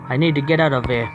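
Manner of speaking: usual speaking voice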